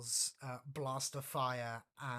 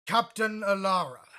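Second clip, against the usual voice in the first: louder, angry menace not quite yelling; Vader's menace; 6 semitones higher, 15 dB louder than usual. Acted. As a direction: loom over her with a chilling threat. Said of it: "a deep basso voice, angry and not quite yelling, but with a menace".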